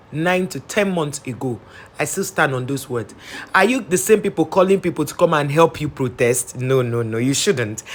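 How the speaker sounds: ordinary talk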